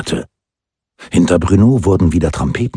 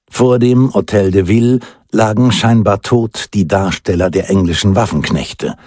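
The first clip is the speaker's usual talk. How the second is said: ordinary delivery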